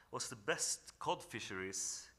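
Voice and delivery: normal talking voice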